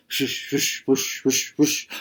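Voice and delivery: breathy whooshing swim sound; swimming whoosh. A narrator's reading. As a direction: puff out each stroke like paddling water. Narrator imitates swimming sound.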